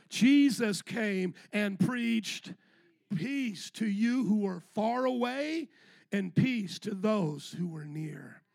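slow, weighty, drawn out; slow scripture reading. Acted. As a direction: lay each phrase down like a proclamation.

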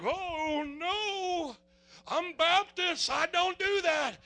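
high, drawn-out mock dismay; hammed-up churchgoer refusing in alarm; balking Baptist. Voices Baptist friends balking at tongues.

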